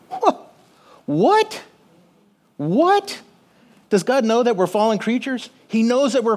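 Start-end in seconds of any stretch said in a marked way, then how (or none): 0.1-3.2 high, rising astonished "what?"; stunned double take; mock disbelief. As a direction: sound amazed, as if you can't believe it. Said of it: "mock disbelief at the promise".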